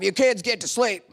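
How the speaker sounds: mock stern parent barking at the kids; a touch of a gruff bedtime command; stern bedtime order. Parent snaps kids to bed.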